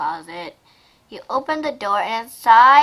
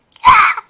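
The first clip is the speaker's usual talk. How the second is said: sharp high gasp; 10 dB louder than usual. Real, not acted. Reacting to story.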